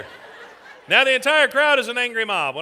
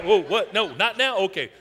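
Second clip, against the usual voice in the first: ordinary talk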